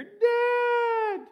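put-on high, loud child's call from the doorway; child calling Dad. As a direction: shout it like a small kid bursting in. Child bursts into office calling.

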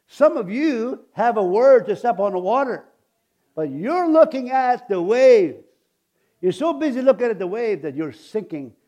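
fiery sermon voice; preaching at full force. Acted.

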